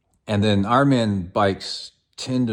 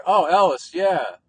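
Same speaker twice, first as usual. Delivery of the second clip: high, louder mock "oh yeah" of recognition; imitated recognition; 9 semitones higher, 6 dB louder, slower than usual. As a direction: act out an old guy placing the name. Old guy recognizing a family, retold.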